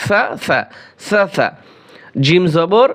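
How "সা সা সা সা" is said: touch the tongue tip to the upper front teeth for each "সা"; tongue-tip dental sound. Shown as a demonstration. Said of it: "জিহ্বার আগা উপরের দুই দাঁতের আগার সাথে".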